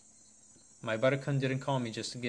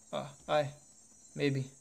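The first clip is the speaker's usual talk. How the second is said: fearful; 4 semitones higher, much slower than usual. Acted. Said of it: "There are fear and certainties in his voice".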